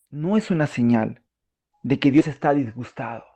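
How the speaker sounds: ordinary talk, nothing marked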